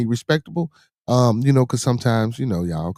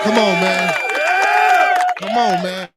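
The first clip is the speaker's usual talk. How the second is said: loud, high cheer; excited shout; 8 semitones higher, 5 dB louder than usual. Real, not acted. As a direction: holler it in celebration. Celebrating a new donation.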